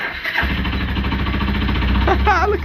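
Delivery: giddy, breathless high laugh; high shrieking laugh. Real, not acted. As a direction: laugh in delighted disbelief. Engine fires again.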